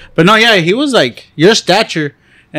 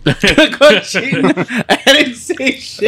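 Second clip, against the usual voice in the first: laughing; 8 semitones higher, much slower than usual. Real, not acted. Laughing denial among friends.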